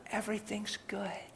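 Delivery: soft, whispered reassurance; hushed reassurance. Retold or imitated. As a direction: settle them down almost in a whisper. Calming a worried woman.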